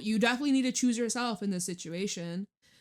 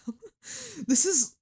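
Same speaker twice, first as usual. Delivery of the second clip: mock exasperated; 8 semitones higher, 5 dB louder than usual. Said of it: "Be like".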